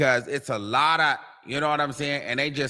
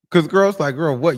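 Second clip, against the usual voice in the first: excited; 3 semitones higher, 6 dB louder than usual. Real, not acted. Excited retelling.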